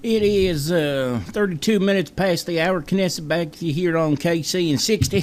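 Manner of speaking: high-pitched put-on Southern accent. Host welcoming listeners back on air, high Southern accent. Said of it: "I used to love doing the Southern accent".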